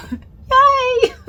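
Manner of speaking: squealed high, loud cheer; delighted shriek of a yay; excited yay. Real, not acted. Cheering at being back.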